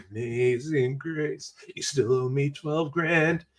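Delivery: slow, soft sarcastic drawl; mock piety then twist the knife; sarcastic grudge. Forgiven man to friend owing money, slow sarcastic jab.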